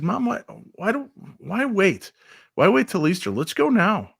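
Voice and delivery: excited son pleading with mom to go now; eager urging. Retold or imitated. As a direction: hurry her along with excitement. Urging mom to visit grandpa.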